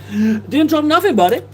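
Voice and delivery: jokey high-pitched repeat of a chat message; silly high voice. Pretend. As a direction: squeak it out like a cartoon goof.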